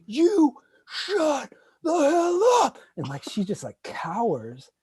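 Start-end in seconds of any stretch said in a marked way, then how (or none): 0.1-2.8 high, slow, loud barked order; mock angry shout. Neighbor shouting at his sister, high, slow mock shout. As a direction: scream her down again. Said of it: "And he's like".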